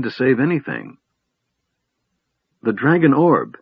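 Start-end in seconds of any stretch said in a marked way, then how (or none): 2.6-3.6 higher, fearful gasp of words. A narrator's reading. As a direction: say it with sudden fear.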